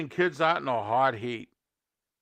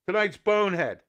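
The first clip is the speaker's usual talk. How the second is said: normal talking voice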